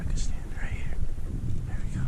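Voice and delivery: hushed whisper. Real, not acted. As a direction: whisper it softly. Stepping onto rocks quietly.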